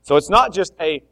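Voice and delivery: loud, driving preaching; loud fired-up sermon; full-force preaching. Acted. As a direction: press the point hard from the pulpit.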